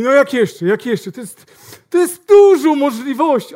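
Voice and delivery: hammed-up salesman caught off guard; flustered salesman. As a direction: stammer it out loudly.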